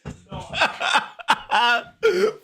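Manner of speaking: high pained squealing laugh. Real, not acted. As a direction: laugh through the sour pain. Laughing at sourness.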